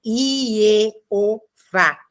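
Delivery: "Ievá" drawn out in separate syllables, I-e-o-vá; syllable-by-syllable pronunciation. Shown as a demonstration. Teacher showing an impossible Hebrew reading, sounded out in syllables. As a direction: sound out "Ievá" piece by piece, ending on a stressed VÁ. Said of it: "não dá pra fazer essa pronúncia".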